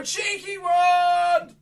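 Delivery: very loud, high, slow cheeky wand. Shown as a demonstration. Magician urging kids, loud drawn-out shout. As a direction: belt it so they hear. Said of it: "I can't hear you".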